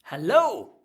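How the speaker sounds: sudden blasting hello; loud hello. Pretend. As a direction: boom it out. Loud punch sound gag.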